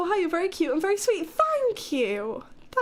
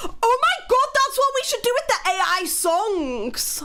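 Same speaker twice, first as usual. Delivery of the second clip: excited outburst; 6 semitones higher, 4 dB louder than usual. Real, not acted. Sudden excited idea.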